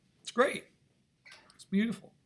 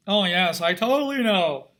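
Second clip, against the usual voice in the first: loud, far put-on voice of a rich society lady; hammed-up snooty socialite bragging about her home; 7 dB louder than usual. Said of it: "that sort of just that Richie voice".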